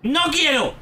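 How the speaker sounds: high, insistent outcry; protesting refusal. Real, not acted. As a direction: cry out a stubborn no. Refuses the game's summons.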